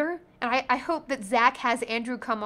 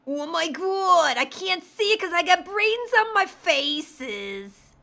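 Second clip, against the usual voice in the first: put-on a lightly done Brooklyn accent, drawn out and higher; hint of a Brooklyn accent, slow and pitched up; 6 semitones higher, 4 dB louder, slower than usual. Answering chat's Brooklyn request in a light Brooklyn accent. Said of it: "which race for Brooklyn accent".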